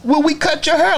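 high, mock-innocent asking; mocking viewer question. Barber mocking viewers who ask on live, high mock voice. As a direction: mimic a clueless customer asking.

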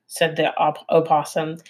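plain reading voice

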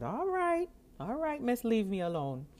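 high, brushing-off all right; dismissive. Retold or imitated. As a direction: wave her off with a sigh. Brushing off the office worker.

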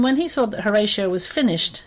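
usual speaking voice

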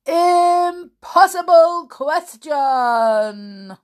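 high, loud, slow dramatic build; dramatic teaser. Pretend. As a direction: tease it like a game show.

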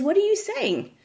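high-pitched exasperated question; acted incredulous protest; baffled indignation. A narrator's reading. Offended patient challenges doctor's advice.

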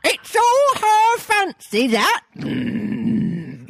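high slow parrot squawk; griffin parrot voice. Acted. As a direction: stretch it out in a squawk. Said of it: "He's on a register".

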